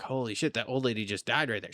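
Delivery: low, hushed disbelief under the breath; hushed shock. Retold or imitated. Traveller retelling his shocked reaction at the airport, hushed. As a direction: murmur it like you can't believe what you saw. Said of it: "And I'm like".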